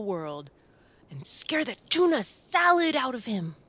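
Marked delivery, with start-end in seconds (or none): 1.3-3.5 higher, louder, gleeful punchline; excited burst. A narrator's reading. Narrator bursts out with the scare plan, excited. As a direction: throw the tuna salad line out big.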